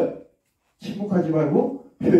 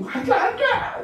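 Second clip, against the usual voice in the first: put-on much higher defiant child yell. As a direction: stamp your foot like a kid refusing school. Child refusing school loudly. (떼쓰는 아이의 외침)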